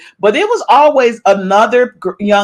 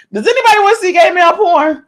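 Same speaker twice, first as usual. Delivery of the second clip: higher and faster, mock disbelief; high incredulous repeat; 9 semitones higher, much faster than usual. Shown as a demonstration. Asking viewers again in a higher, faster voice. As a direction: throw the question up high, rushing it. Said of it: "repeats the question, higher".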